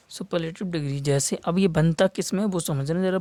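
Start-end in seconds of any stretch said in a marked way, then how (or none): none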